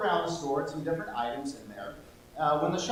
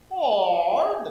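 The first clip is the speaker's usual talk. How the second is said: higher, louder grandma voice; old lady voice; 6 semitones higher, 8 dB louder than usual. Acted. Shopkeeper offering the girl in old lady voice. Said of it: "my old lady voice".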